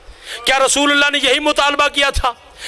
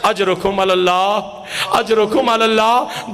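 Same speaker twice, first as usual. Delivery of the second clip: mournful condolence; 6 semitones lower than usual. Real, not acted. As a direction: intone it low as a mourning refrain. Mourning formula at a majlis.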